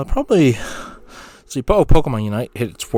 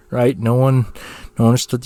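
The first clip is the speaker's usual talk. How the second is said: normal talking voice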